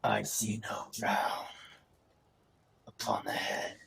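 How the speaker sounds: slow, hissing creature whisper. Acted. GM voices creature replying.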